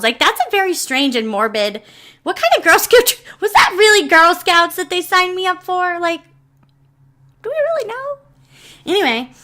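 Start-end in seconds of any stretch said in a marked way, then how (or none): 2.2-8.2 much higher-pitched mock dismay; incredulous. Real, not acted. Questioning what Girl Scouts they signed me up for, incredulous. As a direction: squeak out baffled, disbelieving questions.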